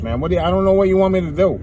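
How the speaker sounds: ordinary talk, nothing marked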